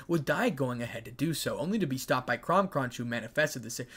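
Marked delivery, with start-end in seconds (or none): none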